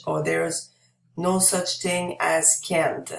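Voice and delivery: normal talking voice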